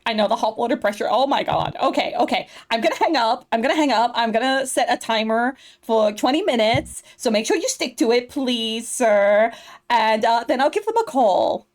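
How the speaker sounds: put-on bubbly Valley Girl assistant. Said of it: "doing that Valley Girl voice".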